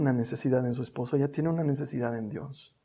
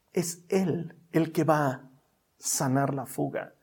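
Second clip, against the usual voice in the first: ordinary talk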